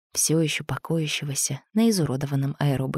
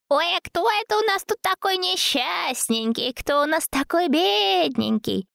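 put-on baby talk; 13 semitones higher than usual. A narrator's reading. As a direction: coo at him like a baby. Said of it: "Сюсюкаясь".

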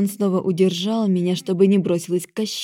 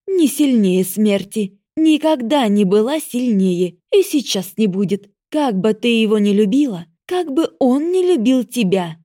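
loud prickly goddess; 3 semitones higher, 5 dB louder than usual. Acted. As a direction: pronounce doom with harsh scorn. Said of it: "голос богини стал громким и колючим".

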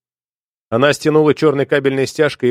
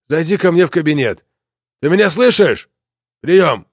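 loud, higher commanding radio call; Бофельд angry boss; 3 semitones higher, 3 dB louder than usual. Acted. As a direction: bark orders through a radio. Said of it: "Приказ явно отдавал Бофельд".

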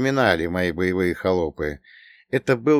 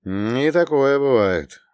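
slow, pensive, higher swell then fall; thoughtful drawl; 5 semitones higher, 4 dB louder, slower than usual. A narrator's reading. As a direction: stretch it out thoughtfully. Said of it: "задумчиво протянул я".

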